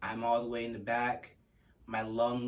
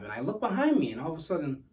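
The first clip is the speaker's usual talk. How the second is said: ordinary talk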